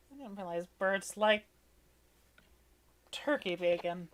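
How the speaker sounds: normal talking voice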